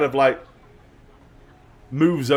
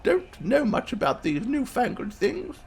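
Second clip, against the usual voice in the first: put-on high-pitched, befuddled old lady; apologetic grandmother; 12 semitones higher than usual. Grandma apologising to a visitor about newfangled things, befuddled. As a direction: play a grandma out of her depth.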